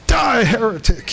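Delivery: a hammed-up cry of holy wrath; mock fanatical outburst. Teller voicing the Baptist who pushes the other man off the bridge, mock furious shout. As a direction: shout it high and sudden like a zealot. Punchline of a retold church joke.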